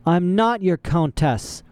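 a touch of irritation, spelling it out; testy. A narrator's reading. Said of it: "Violet said testily".

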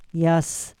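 understated sad assent. A narrator's reading. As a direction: agree with a touch of sorrow. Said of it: "said the emperor sadly".

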